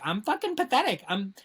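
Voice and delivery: mock self-pity whine. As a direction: whine about bad luck. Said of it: "I'm just like".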